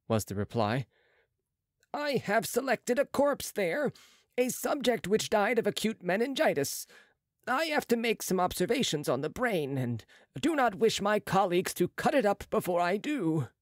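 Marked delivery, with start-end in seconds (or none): 1.9-13.5 higher, brisk surgeon's voice; a proud young surgeon, pitched up; Marat's voice. A narrator's reading. Marat voiced high by narrator.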